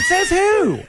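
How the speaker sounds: So what thrown out high and defiant; a high, hammed-up sheriff hopeful; high mock defiance. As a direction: fling it back in a high squeal. Parody cop defiant, laughing.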